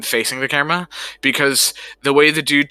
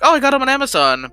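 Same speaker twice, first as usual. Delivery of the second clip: high excited reply; 13 semitones higher, 4 dB louder than usual. Retold or imitated. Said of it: "I'm like".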